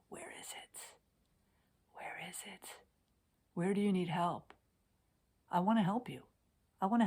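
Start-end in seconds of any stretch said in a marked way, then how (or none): 0.1-2.8 much softer, breathy whisper. Shown as a demonstration. Coach repeating the question to viewers in a whisper.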